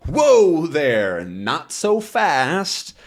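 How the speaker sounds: slow, drawn-out policeman's voice; Thompson's gruff stop. Acted. Thompson grabbing Tintin's neck, slow stern detective voice. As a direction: hold him back, slow and stern. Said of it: "grabs him by the neck and says".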